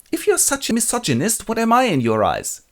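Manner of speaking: high-pitched retort; hammed-up offended woman's voice; challenging wife. Wife challenges misogynist husband.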